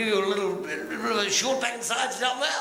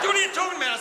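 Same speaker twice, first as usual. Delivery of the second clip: high, exasperated question; mock-exasperated policeman; baffled cop; 8 semitones higher, much faster than usual. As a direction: demand it, pitched up in bafflement. Policeman baffled by driver in joke.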